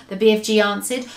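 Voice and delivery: plain reading voice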